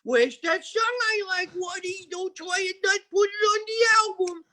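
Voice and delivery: bully the producer down the line; the manager rings Waddy with a very high demanding yell; manager on the phone. Retold or imitated. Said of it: "He'd ring up Waddy and go".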